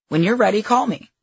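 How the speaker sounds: bold, insistent life coach; confident coach voice. A narrator's reading. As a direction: call her out with confidence. Coach urging her to call.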